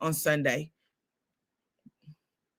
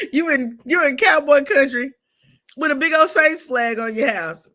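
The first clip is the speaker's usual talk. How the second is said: higher, louder teasing mailman voice; 8 semitones higher, 6 dB louder than usual. Retold or imitated. Imitating mailman Tony teasing her about the flag, loud. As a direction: rib her like the mailman did. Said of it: "He said".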